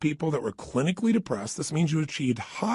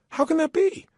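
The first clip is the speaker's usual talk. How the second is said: much higher, puzzled question; mock puzzlement; 13 semitones higher than usual. Speaker voicing a baffled listener's question. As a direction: play baffled. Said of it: "rhetorical mock wonder".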